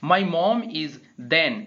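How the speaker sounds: teacher reading slowly. Shown as a demonstration. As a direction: slowly read example. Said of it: "अब हम पढ़ते हैं second को".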